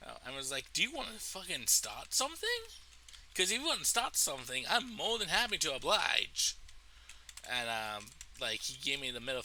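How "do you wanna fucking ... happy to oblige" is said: higher-pitched, swooping taunt; mock confrontational, pitched up; retold challenge. Retelling his challenge before a fight.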